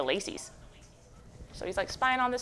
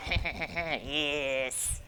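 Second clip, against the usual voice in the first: sinister; 4 semitones lower, 7 dB quieter than usual. Acted. Monster watching through window.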